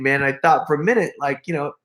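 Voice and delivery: ordinary talk, nothing marked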